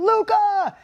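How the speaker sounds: scream across the room. Retold or imitated. Father greeting his son across the room, joyful shout. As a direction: call across the room with delight. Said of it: "I scream across the room".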